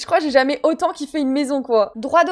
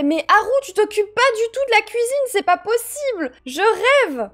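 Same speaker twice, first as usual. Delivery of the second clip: high, indignant scolding; mock outrage; 7 semitones higher than usual. Player telling Haru off about the kitchen, mock outraged. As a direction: scold in high-pitched disbelief, slowing at the end.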